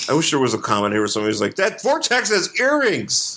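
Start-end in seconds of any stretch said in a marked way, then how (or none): 1.5-3.4 high, drawn-out comic outburst; mock outraged exclamation. Imagined onlooker pointing out the vortex's earrings, mock outcry.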